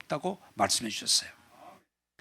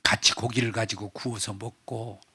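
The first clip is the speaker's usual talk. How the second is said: normal talking voice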